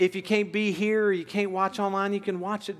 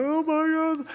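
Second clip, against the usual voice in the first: retold tearful gasp; 11 semitones higher than usual. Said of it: "I started crying".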